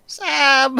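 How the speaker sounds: high call across the shop. Retold or imitated. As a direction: call the name from far off. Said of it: "I'd hear".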